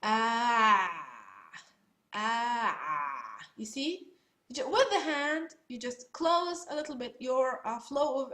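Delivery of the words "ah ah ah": ah pushed out through a squeezed throat; half-strangled ah, airflow cut off; choked throat ah. Shown as a demonstration. Teacher showing students the choke for Ain, strangled ah. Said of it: "choke yourself in simple words".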